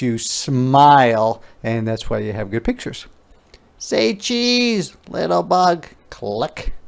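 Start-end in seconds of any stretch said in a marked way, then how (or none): 3.8-5.9 put-on high little girl voice, cheerful; a small girl snapping photos, high and bright; little girl playing photographer; Ruthie's voice.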